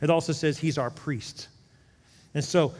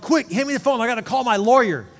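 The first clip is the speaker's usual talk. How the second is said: much higher, louder panicked plea; mock panic; 8 semitones higher, 9 dB louder than usual. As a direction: act like your life just fell apart. Panicked person in crisis.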